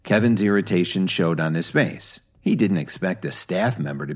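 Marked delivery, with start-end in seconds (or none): none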